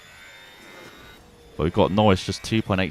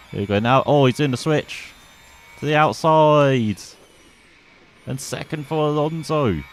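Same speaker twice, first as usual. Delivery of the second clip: higher, louder race call building; excited commentary; 8 semitones higher, 5 dB louder than usual. Real, not acted. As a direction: call the pass as it happens. Overtake called live, rising.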